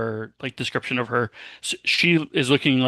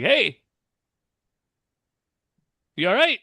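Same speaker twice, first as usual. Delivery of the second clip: higher-pitched call to a stranger; mildly raised, high-pitched hey-there; 9 semitones higher than usual. Acted. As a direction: hail someone to get their attention. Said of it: "kinda loudly wave at her".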